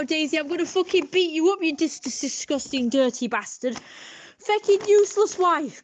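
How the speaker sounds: high-pitched posh rant at a wife; dead posh abusive husband ranting; posh accent. Acted. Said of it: "he says it in a posh accent because he's dead posh".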